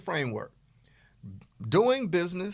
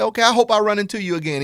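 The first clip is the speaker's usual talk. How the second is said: louder, higher and fast; cheery goodbye; 8 semitones higher, 8 dB louder, faster than usual. Retold or imitated. Guy leaving the girl, cheery, loud and quick. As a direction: chirp it out happily on the way out. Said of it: "You say".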